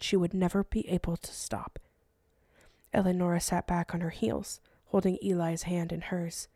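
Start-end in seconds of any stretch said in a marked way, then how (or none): none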